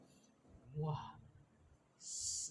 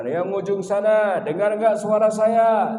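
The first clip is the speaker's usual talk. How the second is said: calling to the back; 5 semitones higher, 6 dB louder than usual. Shown as a demonstration. Preacher tests his voice on the far end of the hall, calling out loud. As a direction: project to the back row. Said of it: "Kalau mau cek mentalnya antum".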